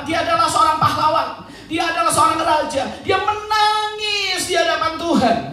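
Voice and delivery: high sermon cry with long-held words, growing loud; impassioned preaching. Real, not acted. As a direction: preach it fervently, stretching the weeping words. Preaches at full force. (khotbah berapi-api dengan nada tinggi)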